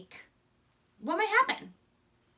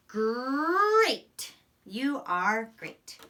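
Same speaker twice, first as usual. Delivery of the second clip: slow praise; much slower than usual. Shown as a demonstration. Teacher praising children while teaching great. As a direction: savor each word of praise.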